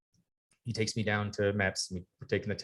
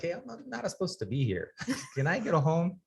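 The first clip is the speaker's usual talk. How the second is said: higher, laughing mock plea; naive plea; 9 semitones higher than usual. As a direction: play the clueless recruit begging to go home. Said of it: "I'm sitting there, I'm like".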